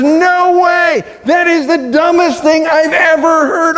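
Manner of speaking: high, shouted incredulous no way; strong outburst. Retold or imitated. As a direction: blurt it out in disbelief, pitched high. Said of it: "it is in very strong language".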